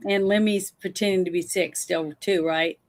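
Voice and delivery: ordinary talk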